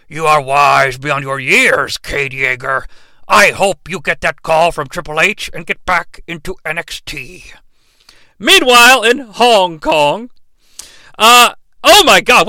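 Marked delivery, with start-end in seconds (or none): 0.1-7.5 grave noble robot wisdom; Optimus Prime voice. Acted. Optimus answers Cade in a low, solemn voice. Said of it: "Optimus replies to Cade".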